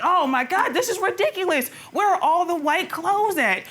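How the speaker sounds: high-pitched mock exasperation; retold rant, zero to a hundred; imitated ranting. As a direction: imitate his over-the-top fuss, pitched well up. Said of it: "you know, ranting and raving".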